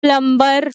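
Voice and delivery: प्लम्बर said "plum-ber", b and r sounded; wrong "plumber" with b. Shown as a demonstration. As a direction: pronounce the b in प्लम्बर. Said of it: "बहुत सारे लोग इसको बोलते हैं".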